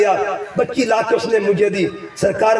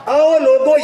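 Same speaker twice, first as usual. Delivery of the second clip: calling out; 6 semitones higher, 4 dB louder than usual. Real, not acted. Preacher calling to the congregation.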